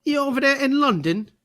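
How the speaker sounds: high, hammed-up English accent; cheeky mock-English accent; bad English accent. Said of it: "do my bad English accent".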